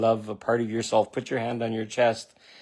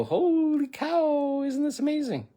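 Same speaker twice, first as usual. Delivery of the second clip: much higher, very slow, marveling at everything; like seeing the world for the first time; wide-eyed wonder. Shown as a demonstration. Showing viewers how to greet life, in wonder. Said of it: "open your eyes and go".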